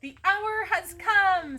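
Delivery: high, loud, slow proclamation; ringing, drawn-out declaration; grand announcement. A narrator's reading. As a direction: herald the big moment to a crowd. Character heralds start of parade.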